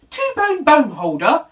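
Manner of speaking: aghast little bear; in dismay. Acted. Little Bear cries out in dismay at Dog. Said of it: "cried Little Bear in dismay".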